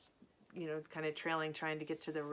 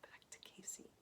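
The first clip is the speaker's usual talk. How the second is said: whisper; 21 dB quieter than usual. Real, not acted. Whispered aside between chapters.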